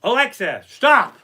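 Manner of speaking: loud, high command to a device; calling out. Real, not acted. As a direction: call sharply across to the speaker. Calling out to a smart speaker.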